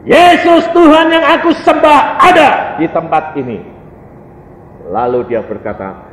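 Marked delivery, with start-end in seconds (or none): 0.1-3.6 high, shouted proclamation of faith; seruan khotbah. Real, not acted. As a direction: proclaim it at full preaching force. Preacher's full-force proclamation. (berseru lantang bernada tinggi)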